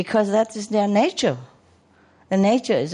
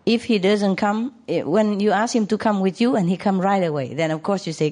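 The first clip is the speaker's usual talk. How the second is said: usual speaking voice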